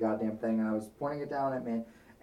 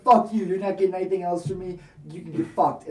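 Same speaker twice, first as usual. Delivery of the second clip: loud, higher furious yell; angry outburst; 5 semitones higher, 5 dB louder than usual. Retold or imitated. Defying the blackmailer, a furious yell. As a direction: snap back with real venom. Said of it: "you started going off on him".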